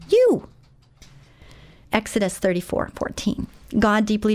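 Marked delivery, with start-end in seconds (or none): 0.1-0.5 You flung out much higher and louder; emphatic you. Real, not acted. Preacher singling out each listener, sudden high loud You. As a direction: hit You hard and high.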